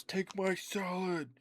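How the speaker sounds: high, slow, drawn-out yell; insistent yell. Retold or imitated. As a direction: bellow it like a pushy wizard offering food. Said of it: "And he goes".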